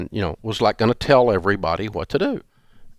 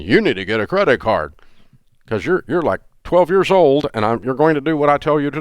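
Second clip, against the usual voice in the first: higher, louder bossy dad lecture; 6 semitones higher, 4 dB louder than usual. Retold or imitated. Father scolding grown kids like children, bossy dad voice. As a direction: talk down to them like a scolding father. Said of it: "that's the dad".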